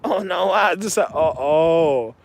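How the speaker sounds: normal talking voice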